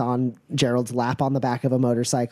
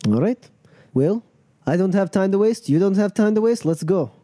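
lightly voiced boss, a little higher; [name], impatient; 7 semitones higher, 3 dB louder than usual. Crew leader wants the riders moving, impatient. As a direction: hurry them along firmly.

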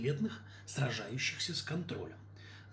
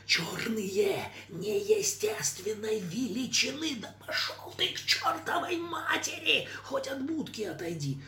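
growling prompter; 10 semitones higher, slower than usual. Acted. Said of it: "Суфлёр рычит".

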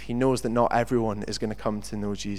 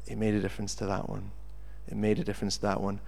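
soft, low-key, quicker delivery; quiet earnest reply; 3 semitones lower, 8 dB quieter, faster than usual. Retold or imitated. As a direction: voice a boy's understated answer. Boy's solemn answer in a story.

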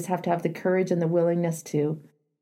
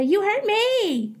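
much higher, drawn-out, accusing "You hurt me"; mock accusation; 12 semitones higher, much slower than usual. Shown as a demonstration. Coach mocking the blaming line Selma shouldn't say, high and accusing. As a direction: whine it like blame you should avoid. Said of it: "You don't have to say".

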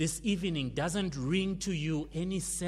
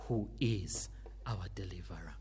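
whisper; 8 semitones lower, 6 dB quieter than usual. Acted. Sermon point, hushed.

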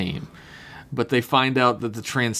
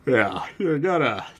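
Patrick Warburton impression. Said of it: "Patrick Warburton is probably the best impression".

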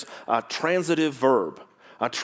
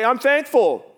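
mock churchgoer; 10 semitones higher, 6 dB louder than usual. People leaving church, acted out.

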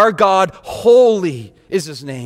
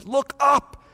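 higher, stronger look up; voicing God, rising; raised call; 7 semitones higher than usual. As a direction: lift the voice on the second look up.

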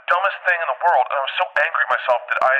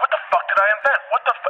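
baffled; 8 semitones higher than usual. Retold or imitated. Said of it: "I'm like".

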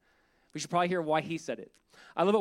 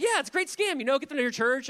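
imitating an eager schemer, pitched up; animated insistence; 6 semitones higher, 4 dB louder than usual. Friend explains his scam to the pastor, insistent. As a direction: jump in high and keen.

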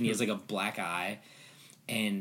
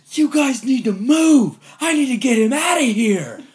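urgent mock shout; 14 dB louder than usual. Actor ordering players aside for the chained man, frantic mock yell. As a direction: yell at them to clear the way.

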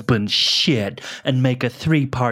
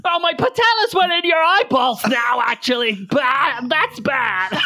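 high hammed-up shouting about "patellas"; mock panic, yelling and giggling; mock shriek; 11 semitones higher than usual. Kneecaps flying, she shrieks.

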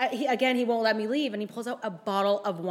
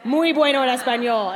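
mock proud Spanish; 3 semitones higher, 10 dB louder than usual. Boasting about school Spanish.

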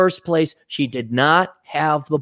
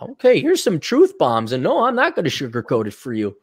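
much higher on lady, taunting; mock lecture; 8 semitones higher than usual. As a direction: talk down to her teasingly.

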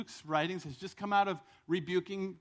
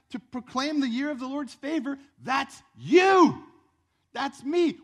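loud, raised-pitch sermon delivery; full-force preaching; 5 semitones higher, 8 dB louder than usual. Acted. Preacher tells congregation the scripture is about them, forceful preaching. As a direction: preach it with force, punching each YOU.

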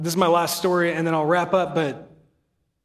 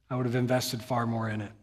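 soft quoted line; 7 semitones lower, 8 dB quieter than usual. Retold or imitated. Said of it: "imagine with an English accent".

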